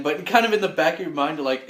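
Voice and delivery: ordinary talk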